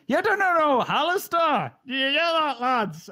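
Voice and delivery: a bad Scottish accent, crowing at the lads. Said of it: "guy with a really bad Scottish accent goes".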